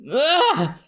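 ordinary delivery

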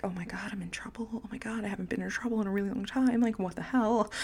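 retold panic, hushed and hurried; soft, fast, whispery fretting to oneself; freaking out. Reliving her fear of being in trouble, freaking out quietly. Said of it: "And I'm freaking out".

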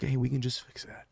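whispered suggestion to himself; soft whispered self-quote; hushed aside. Retold or imitated. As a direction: say it under your breath, almost voiceless. Offhand self-quote, quiet suggestion.

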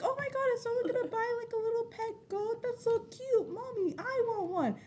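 put-on high, gushing child's voice; little kid voice. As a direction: coo like a six-year-old begging mom. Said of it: "I was like".

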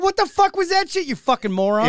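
reliving yelling at the driver who rear-ended him, mock-furious; retold angry yell. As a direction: yell it at the moron again. Retold cursing at other driver.

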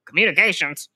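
lightly mocked baffled father; incredulous dad. Dad hearing about communications classes, mildly mock-incredulous. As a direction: question it like a doubting dad. Skeptical dad's reaction acted out.

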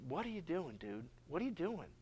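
hushed pleading to a friend; quiet disbelief. Retold or imitated. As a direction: ask a friend under your breath. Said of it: "you'd say".

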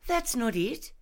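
high, triumphant retort. A narrator's reading. Rosa correcting her friend's guess, triumphantly. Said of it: "she answered triumphantly".

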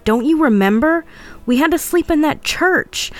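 worked-up narrated yell. A narrator's reading. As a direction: plead with them to remember, pitch climbing. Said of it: "I yelled".

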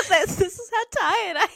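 high, laughing at yourself; giggly tired confession; sheepish laughing admission. Real, not acted. Laughing at own mistake.

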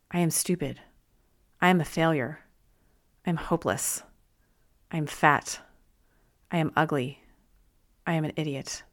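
quiet, deflated inner voice; dejected self-talk. Retold or imitated. Reader voicing the reader's own harsh self-talk, quiet and deflated. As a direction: mutter it like a tired old habit.

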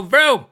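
high, loud spoken vroom; playful mock engine roar; car engine noise. Shown as a demonstration. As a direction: imitate a car revving up. Imitates a car engine starting.

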